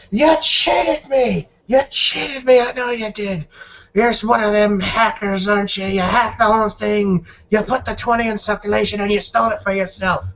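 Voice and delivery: high-pitched, indignant gambler in a rage; enraged accusing gambler. Acted.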